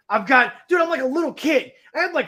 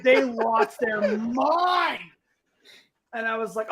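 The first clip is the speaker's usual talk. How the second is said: ordinary delivery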